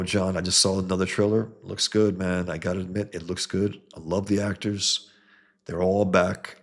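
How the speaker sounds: ordinary talk, nothing marked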